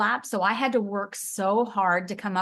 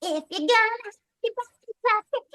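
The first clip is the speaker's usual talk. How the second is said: put-on Scary Girl voice; 13 semitones higher than usual. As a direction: stretch it out high and eerie. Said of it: "and then Scary Girl".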